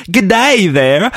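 high, slow mock Aussie greeting; light Australian "Good day there"; Australian accent. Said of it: "she said".

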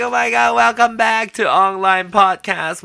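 loud, high, hyped greeting; excited welcome. Acted. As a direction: throw it out like a show host. Podcast opening greeting.

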